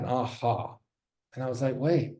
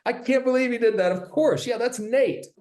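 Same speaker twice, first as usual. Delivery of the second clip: excited fan; 10 semitones higher than usual. Retold or imitated. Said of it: "He goes".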